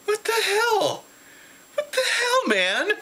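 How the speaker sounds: high squeaky mock outrage, far up in pitch; indignant disbelief. Fan cut off by a robot, squeaking in indignant disbelief. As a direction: squeal it like you just got cut in line. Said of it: "and I'm like".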